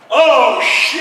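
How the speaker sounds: high, loud sudden oh shit; hammed-up startled curse; mock alarm. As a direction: realize you're lost. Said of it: "He's like".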